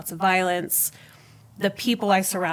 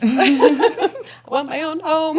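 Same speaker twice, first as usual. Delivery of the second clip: high, loud, drawn-out half-joking whine; laughing admission; 8 semitones higher, 6 dB louder, slower than usual. Real, not acted. Woman joking about living with her parents, laughing plaintively. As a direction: confess it slowly through real laughter.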